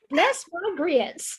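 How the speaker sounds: drawled put-on Southern accent. Shown as a demonstration. Mother showing off her Southern to the host, Southern accent. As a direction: lay on a Southern accent for show. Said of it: "that's the best Southern".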